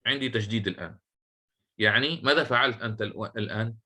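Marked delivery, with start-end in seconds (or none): none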